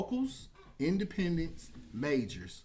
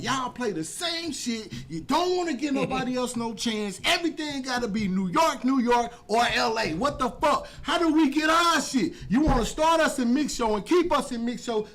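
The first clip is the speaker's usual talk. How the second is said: loud, high retold outburst at radio executives; heated rant; 9 semitones higher, 7 dB louder than usual. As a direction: vent the frustration, getting louder. Acted rant at radio bigwigs.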